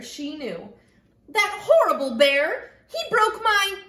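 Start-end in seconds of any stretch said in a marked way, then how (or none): 1.3-3.8 loud, high indignant complaint; upset girl. A narrator's reading. Girl venting to her best listener, upset and loud. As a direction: rant loudly, pitch climbing with outrage.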